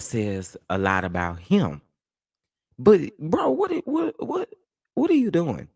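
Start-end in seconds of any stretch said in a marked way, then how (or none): 2.8-5.7 high, incredulous what are you doing; disbelief. Real, not acted. Streamer reacting in disbelief to the rapper's clip. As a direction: react in shock to something absurd.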